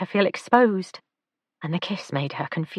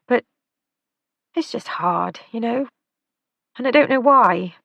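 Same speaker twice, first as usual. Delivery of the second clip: put-on lightly raised, troubled young woman; young woman confessing; 4 semitones higher, 3 dB louder than usual. Lila admits her guilt to Nathan, troubled and earnest. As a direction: Lila unburdening her guilt, a little higher. Lila voiced higher than narration.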